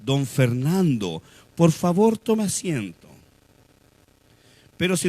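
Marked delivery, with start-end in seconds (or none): 0.0-3.1 slow, drawn-out deferential greeting; fawning welcome. Acted. People greeting a well-dressed don Fernando, slow and fawning. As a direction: gush slowly over an important guest. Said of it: "entonces te van a decir".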